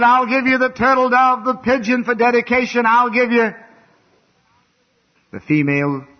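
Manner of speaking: voice of the Lord. Retold or imitated. The Lord speaking to his common folks, booming and high. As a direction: proclaim it like God speaking from above. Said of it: "He said".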